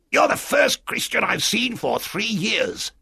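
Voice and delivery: high, slow castaway voice; strange castaway. Acted. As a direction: draw it out high and odd. Castaway Ben Gunn greets Jim.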